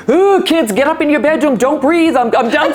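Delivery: put-on overwhelmed mother shooing kids upstairs, frazzled and high; frazzled mom. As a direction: play the fed-up young mom clearing the house. Mom recalls shooing kids away.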